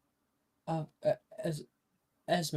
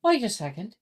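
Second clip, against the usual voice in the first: put-on high, louder girl's interjection; higher girl voice; 10 semitones higher, 7 dB louder than usual. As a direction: jump in high and quick. GM voices Saoirse.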